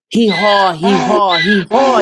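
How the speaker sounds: loud high hee-haw bray, called out three times; donkey braying. A narrator's reading. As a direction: bray like a donkey calling for his master. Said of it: "he cried".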